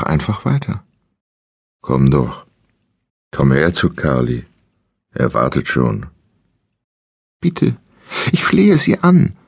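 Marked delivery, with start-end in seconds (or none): 1.8-6.1 deep pressed voice. Acted. Kidnapper coaxing his captive, low pressed creepy voice. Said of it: "verfiel in eine Art Singsang". 7.4-9.4 desperate begging. Acted. Captive begging the kidnapper.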